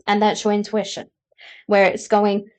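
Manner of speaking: ordinary talk, nothing marked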